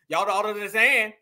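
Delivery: "Y'all don't understand" slurred into one mumbled run; mock fumbled words. Commentator mimicking the clip, "Y'all don't understand" mockingly garbled. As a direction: fumble through "Y'all don't understand", swallowing the middle syllables. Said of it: "she fumbled through the word on purpose".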